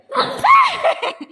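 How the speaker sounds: very high loud laughing shriek. Real, not acted. Laughing shout at the child. (जोरात हसून किंचाळणे)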